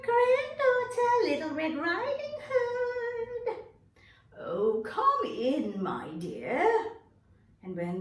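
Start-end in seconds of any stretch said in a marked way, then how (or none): 0.0-3.6 high, sing-song fake sweet voice; wolf pretends to be the little girl, much higher and louder; wolf faking a girl's voice. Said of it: "The big bad wolf said". 4.4-6.9 put-on slow, louder old-lady welcome; drawn-out granny invitation; grandma voice. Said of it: "The big bad wolf said".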